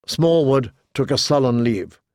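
plain reading voice

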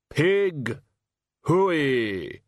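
a drawn-out farm call; pig call. Acted. Hogfather trying a farmer's pig call, drawn out. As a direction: call the pigs in.

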